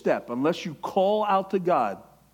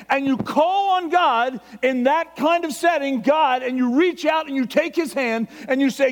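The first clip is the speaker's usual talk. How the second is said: loud, high, impassioned preaching; excited shouting sermon; fervent preaching shout; 9 semitones higher, 7 dB louder than usual. Real, not acted. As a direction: preach it at full volume with the voice raised. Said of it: "My voice raises".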